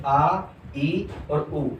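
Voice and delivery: आ, ई और उ each stretched very slowly; long vowels drawn out. Shown as a demonstration. Teacher drawing out the long vowels for students. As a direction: hold every vowel long as a demo. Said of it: "ये आपके दीर्घ स्वर हैं".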